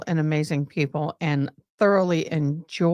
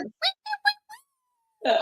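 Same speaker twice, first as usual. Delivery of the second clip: squealing wait; much faster than usual. Retold or imitated. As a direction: squeak it all the way down. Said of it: "I would've gone".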